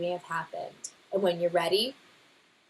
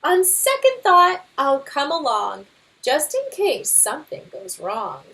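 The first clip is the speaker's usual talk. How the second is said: louder, blustering know-it-all father bear; boastful Papa Bear; 6 semitones higher, 5 dB louder than usual. Acted. Father bear brushing off the scouts' guidebook, boastful. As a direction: scoff at the book with bravado.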